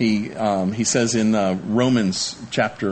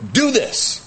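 mock command; 13 semitones higher than usual. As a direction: snap it as a command. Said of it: "He can command them".

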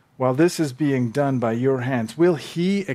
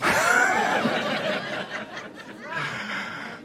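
breathy laugh; 13 semitones higher than usual. Real, not acted. Laughs at own punchline.

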